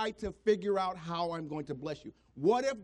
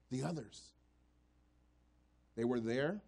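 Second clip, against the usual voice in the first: hushed refrain; 6 semitones lower, 5 dB quieter than usual. Acted. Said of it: "repeated refrain".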